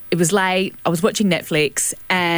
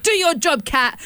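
higher-pitched mock command to a pet; bossy mum; 6 semitones higher, slower than usual. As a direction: imitate a mum ordering the cat about. Mum ordering the cat about.

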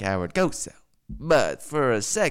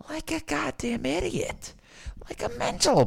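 high squeak. Pretend.

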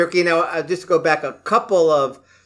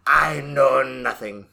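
low, slow mock German accent; 4 semitones lower, much slower than usual. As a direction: draw it out in a lower German accent. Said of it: "There's my German".

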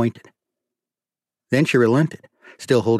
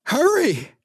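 shouted. A narrator's reading. Woman shouting to the reverend at the bullhorn, high shriek. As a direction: yell it back from the house. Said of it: "she shouted back".

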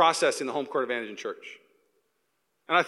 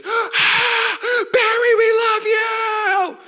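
high, drawn-out stadium scream; hammed-up crowd adoration, stretched long; adoring fan scream; 7 dB louder, much slower than usual. Voices stadium of adoring fans.

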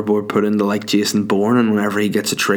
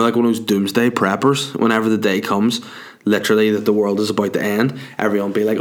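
ordinary talk

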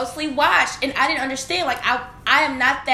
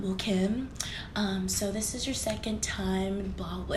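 hushed, lower-key manager reprimand; imitated disapproving boss; 3 semitones lower, 10 dB quieter than usual. A boss telling an employee off for lateness, imitated quiet reprimand. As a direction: softly mimic a boss's awkward telling-off.

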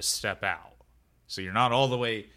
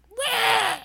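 very high, loud shriek; startled yelp; 8 dB louder than usual. Acted. Doug yelping as the creature ducks, very high and loud. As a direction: yelp in shock at the dodge. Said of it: "and he's like".

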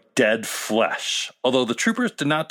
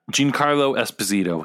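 ordinary talk

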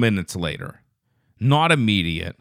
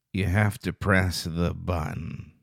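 put-on slow, low, quiet monotone of a zoned-out kid; creepy kid's cryptic warning, drawn out; vacant troll kid; 5 semitones lower, 7 dB quieter than usual. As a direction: intone it slowly and softly, staring into space. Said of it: "just staring into space".

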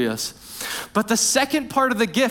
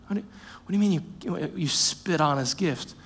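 doubting listener; 4 semitones lower, 7 dB quieter than usual. Retold or imitated. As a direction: lightly voice a confused listener asking quietly. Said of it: "And you might say".

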